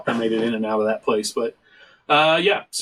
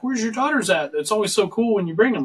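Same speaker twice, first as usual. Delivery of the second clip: put-on higher, bubbly young barista voice; imitating the barista; 8 semitones higher than usual. Barista greeting a regular dad, bubbly and higher. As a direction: play the chatty barista, pitched up. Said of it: "the other one behind the counter is like".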